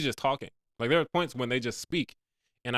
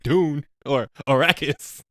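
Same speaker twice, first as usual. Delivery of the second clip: raised-pitch mock scolding; exasperated retort; 5 semitones higher, 5 dB louder than usual. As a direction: tell him off, comically fed up. Mock scolding of character thinking aloud.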